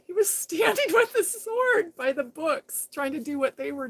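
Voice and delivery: laughing through the words, high and loud; can't stop giggling while telling it. Real, not acted. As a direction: breaks into laughter mid-line. Laughing retelling of man posing.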